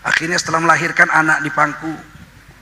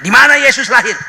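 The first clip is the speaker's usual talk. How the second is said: asking loudly; 6 semitones higher, 6 dB louder than usual. Retold or imitated. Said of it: "Orang Batak ditanya".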